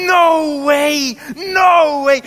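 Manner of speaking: high, loud disbelieving yell; stunned clubber shouting in disbelief; astonished shout. Retold or imitated.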